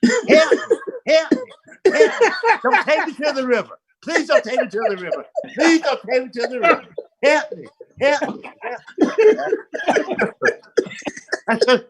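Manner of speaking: high-pitched, hammed-up cries for help; mock panicked yelling. Friend yelling from his seat at a traffic stop, mock yelling. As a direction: plead in a high, comic panic. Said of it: "he started yelling".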